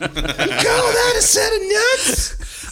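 high, slow, laughing screech; rock star screech. Acted. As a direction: screech it like a glam rocker. Rock star impression continues.

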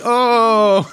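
high, drawn-out "oh" of mock awe; mock realisation. Podcaster mimicking a reader hit by a comeback, mock realisation. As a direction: act floored by the clever comeback.

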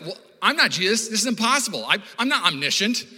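high, loud protest; acted exasperation, voice pushed up; overwhelmed objection. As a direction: protest as if the task is hopeless. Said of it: "a lot of you are like".

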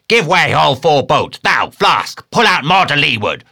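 high, loud captain's hail; bellowed sea orders; shouted orders. A narrator's reading. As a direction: call commands from boat to boat. Ahab hailing the boats.

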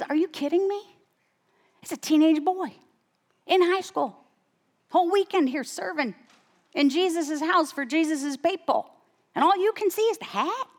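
high-pitched, incredulous inner outburst; retold indignant disbelief. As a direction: act out an outraged mother, pitched high. Acts out indignant thoughts at man.

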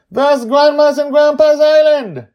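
put-on a girl calling out her delight; excited child Joy. Joy points down at the ocean, crying out high and loud.

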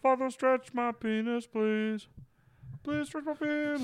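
high falsetto pleading cry; loud high begging preacher; preacher wail. Pretend. Mocking preacher chant.

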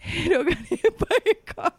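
laughing through the line. Retold or imitated. Acting out the sad hoodie reveal.

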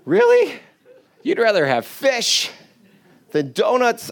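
high, disbelieving really, then slow; can't believe anyone would choose fish; incredulous really. Retold or imitated. Preacher mocking the Israelites, incredulous high squeak. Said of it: "And I'm thinking".

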